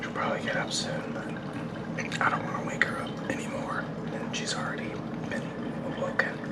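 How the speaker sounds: soft breathy whisper, not to wake anyone; hushed morning whisper. Real, not acted. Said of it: "I'm whispering".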